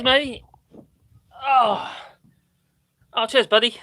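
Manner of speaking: ordinary talk, nothing marked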